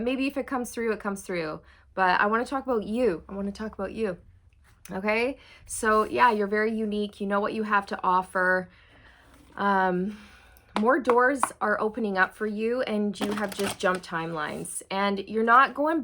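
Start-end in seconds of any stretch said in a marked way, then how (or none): none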